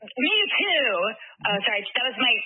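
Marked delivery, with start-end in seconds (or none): 0.0-0.9 put-on high-pitched New Yorker accent. Actress reenacting her one movie line, New Yorker accent. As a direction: deliver the bit part as a New Yorker. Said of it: "that was my New Yorker accent coming through".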